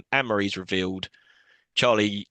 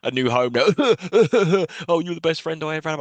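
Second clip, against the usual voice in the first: mock Charlie quote; 5 semitones higher than usual.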